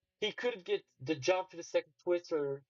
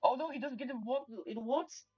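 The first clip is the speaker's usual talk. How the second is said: high, urgent dismay; alarmed commentary; 9 semitones higher than usual. Real, not acted. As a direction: call the setback with real alarm. Live commentary as the run falters.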